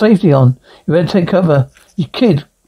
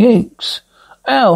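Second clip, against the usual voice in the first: normal talking voice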